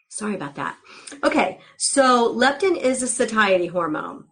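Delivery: usual speaking voice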